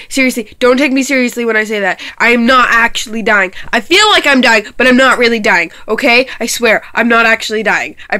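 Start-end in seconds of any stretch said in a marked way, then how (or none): none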